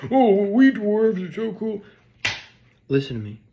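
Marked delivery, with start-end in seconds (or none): 0.1-1.8 high, slow, louder dopey dwarf boast; goofy dwarf. Pretend. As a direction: brag like a dim dwarf. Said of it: "the dwarf's like".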